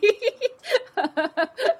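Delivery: a high, rising burst of laughter; real high-pitched laugh. Real, not acted. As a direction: laugh out loud, really tickled. Laughing at her own claim.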